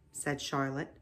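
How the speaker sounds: ordinary delivery, nothing marked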